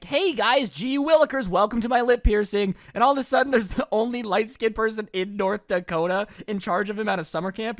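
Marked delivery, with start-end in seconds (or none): 0.0-2.7 put-on higher, eager goody-goody kid voice; gee-whiz dork, chirpy and innocent; nerdy kid voice. The kid's usual voice before the rapper act, nerdy and higher. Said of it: "this kid normally is talking like".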